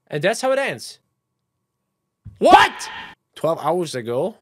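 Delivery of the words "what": loud high shocked yell; shocked shout. Real, not acted. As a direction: blurt it out in disbelief. Shocked outburst at ending.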